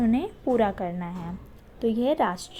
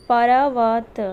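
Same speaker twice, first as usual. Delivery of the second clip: पर्वत said very loud, the र clipped fast; पर्वत called out emphatically; loud demo word; 9 dB louder, much slower than usual. Shown as a demonstration. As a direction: punch पर्वत loudly for the class. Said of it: "आप ध्यान देंगे".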